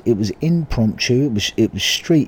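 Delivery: ordinary delivery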